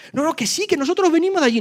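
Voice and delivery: high pleading insistence; insisting. Acted. Lepers insisting to the doubting king, high pleading. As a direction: push back, voice climbing.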